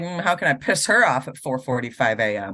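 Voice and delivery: a hint of a sly cat plotting; scheming cat. Voicing the cat's thoughts.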